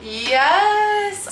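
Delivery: high, loud cheer; excited yes. Real, not acted. Unboxing vlogger cheering to viewers, thrilled. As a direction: celebrate the win. Machine case opens.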